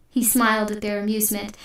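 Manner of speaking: usual speaking voice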